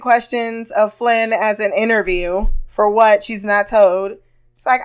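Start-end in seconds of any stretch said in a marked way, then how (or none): none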